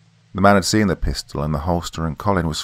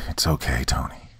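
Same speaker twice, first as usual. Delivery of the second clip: soft, dying near-whisper; a faint reassuring breath; weak and dying; 4 semitones lower, 5 dB quieter than usual. A narrator's reading. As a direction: fade out gently. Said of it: "tried to smile".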